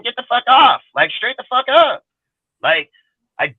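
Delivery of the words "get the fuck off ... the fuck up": angry outburst. Real, not acted. Angry rant at divisive fans.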